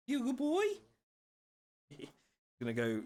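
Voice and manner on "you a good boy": high pet voice. Real, not acted. Owner gushing at his Frenchie in a very high voice. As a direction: jump way up into a squeaky pet voice.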